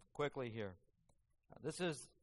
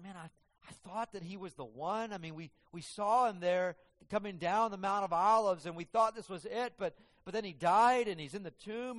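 distraught; 5 semitones higher, 5 dB louder than usual. Acted. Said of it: "they're actually distraught".

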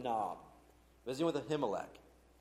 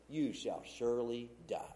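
usual speaking voice

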